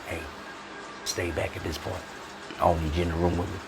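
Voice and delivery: low and very soft; confidential warning. Acted. Patient tells battle buddy to wait outside, hushed. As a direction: quietly wave him off, keeping it private.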